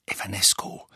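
whispered spell word; murmured spell. Acted. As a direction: breathe the spell under your breath.

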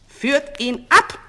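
slow, high, loud command; shouted order. A narrator's reading. False king to his guards, shouting. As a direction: shout each word as an order. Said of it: "schrie der falsche König".

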